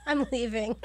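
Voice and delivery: normal talking voice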